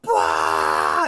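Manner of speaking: drawn-out "blah" yell; re-enacted roar of a yelling fan; wordless fan yell. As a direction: holler wordlessly like a wild supporter. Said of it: "yelling as much as I could".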